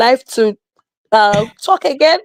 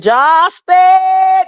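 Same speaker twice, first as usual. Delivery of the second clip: high, loud calling of a name; imitating Amara crying out the name; Amara's voice; 6 semitones higher, 4 dB louder than usual. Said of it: "in Amara's voice".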